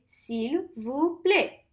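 "S'il vous plaît" said very slowly; slow teacher modelling; slow model. Shown as a demonstration. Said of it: "you can say".

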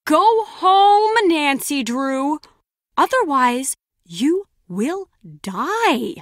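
slow, drawn-out threat; menacing warning. Acted. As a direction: draw out each word as an ominous warning. Delivers a threatening warning to Nancy Drew.

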